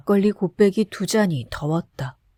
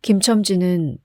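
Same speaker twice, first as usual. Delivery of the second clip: plain reading voice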